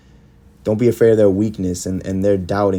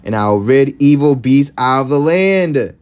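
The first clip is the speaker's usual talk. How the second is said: higher, louder scripture proclamation; forceful preaching; 5 semitones higher, 5 dB louder than usual. Real, not acted. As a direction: proclaim it like judgement.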